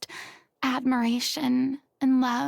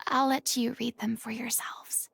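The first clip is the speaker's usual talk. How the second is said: a sigh sliding into a soft whisper; softly, too moved to read on; sigh, then hush; 6 dB quieter than usual. Real, not acted. A sigh and a hush passing on her final words. Handing over a hero's last words.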